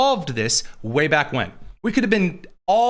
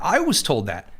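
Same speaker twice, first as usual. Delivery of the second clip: usual speaking voice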